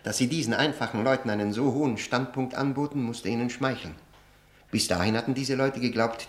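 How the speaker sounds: ordinary talk, nothing marked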